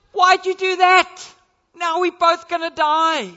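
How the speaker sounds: high, loud, frantic frog voice; panicked frog. Acted. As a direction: cry out in alarm as the stung frog. Frog stung mid-river.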